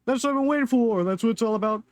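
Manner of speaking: loud, high victory yell; shouting with joy at the win; triumphant cheer. Real, not acted. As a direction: whoop it up over the win. Celebrating a win in game.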